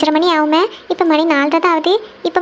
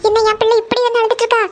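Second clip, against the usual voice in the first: higher-pitched, anxious concern; worried; 4 semitones higher than usual. Acted. Mother finds her daughter Kavya crying early morning, worried. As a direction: fret over a crying child in a raised pitch.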